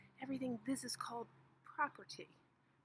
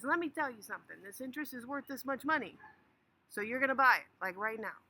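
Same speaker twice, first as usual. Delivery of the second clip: louder, pressing hard to close the sale; pushy salesman; 3 semitones higher, 8 dB louder than usual. Acted. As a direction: lean on him until he buys. Pushy seller pressuring buyer.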